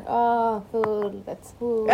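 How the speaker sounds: a half-hearted fake tipsy mumble; badly faked drunk. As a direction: pretend to be drunk at a party, badly. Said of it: "Så jävla dåligt skådespeleri dock".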